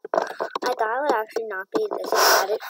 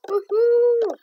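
high, loud whoop; loud delighted whoop; cheer; 12 semitones higher, 10 dB louder than usual. Real, not acted. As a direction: whoop with joy. Cheering while riding.

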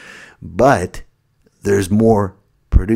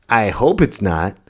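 usual speaking voice

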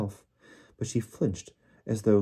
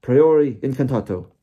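loud roared spell, pitched up; 5 semitones higher, 11 dB louder than usual. Acted. Diggory casting at the wands, roaring the spell. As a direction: bellow the incantation at full force. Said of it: "roared Mister Diggory".

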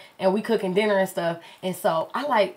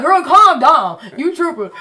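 louder, a bit higher, brushing it off; boyfriend dismissing; 5 semitones higher, 7 dB louder, slower than usual. Retold or imitated. Boyfriend calming the girlfriend with a louder brush-off. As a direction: tell her to chill, loud and dismissive. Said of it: "He like".

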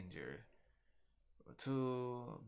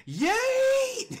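much higher, much louder hype yell; cartoonish victory shout; yeet shout; 14 dB louder than usual. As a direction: fling it with a gleeful scream. Hurling the creature, hyped.

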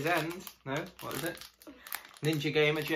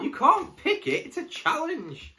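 high teasing; 13 semitones higher, 4 dB louder than usual. Real, not acted. Teasing partner about lucky dip.

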